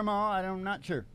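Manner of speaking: usual speaking voice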